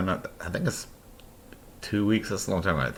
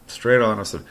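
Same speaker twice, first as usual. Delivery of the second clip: ordinary talk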